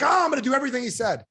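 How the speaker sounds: high, fast mock enthusiasm; excited pledge. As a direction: imitate an overeager seminar attendee. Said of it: "if you're excited tonight".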